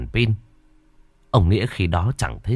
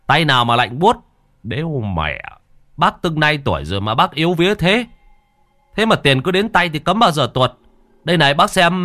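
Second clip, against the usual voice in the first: exasperated, climbing high and louder; scornful scolding; 6 semitones higher, 3 dB louder than usual. Acted. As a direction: scold your scared brother, building in pitch. Younger brother scolds frightened older brother.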